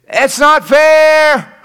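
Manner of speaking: high, slow, put-on whimper; whiny crying. Preacher imagining Joseph crying in the pit, high whiny moan. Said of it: "I can't hear Joseph crying in the pit".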